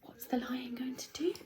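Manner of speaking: hushed, breathy question; suspense whisper. Real, not acted. Teacher whispering to the class about what comes next.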